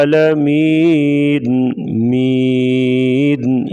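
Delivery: "عالمین" with ع from the throat and long ee; clear throaty ain. Shown as a demonstration. Teacher demonstrates ain and madd, throaty, drawn-out "عالمین". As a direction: open ع clearly, stretch "مین" in a chant. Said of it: "عین کی آواز گلے سے نکالیں گے واضح".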